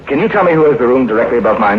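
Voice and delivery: ordinary talk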